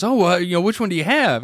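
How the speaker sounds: chirpy pitch of a store clerk; mock salesman. Salesman eagerly quizzing a customer, mock upbeat. Salesman voiced in retold story.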